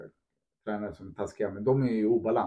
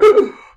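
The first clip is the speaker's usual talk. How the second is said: sharp high gasp, hammed up; mock gasp; 13 dB louder than usual. Mocking a fragile player with a high gasp. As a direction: gasp like someone shocked by a tiny setback. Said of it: "det blir så här".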